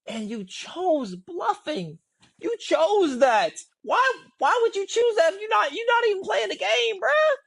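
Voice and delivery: high incredulous complaint, drawing out the key words. Real, not acted. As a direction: protest in high-pitched disbelief. Incredulous complaint about players bluffing.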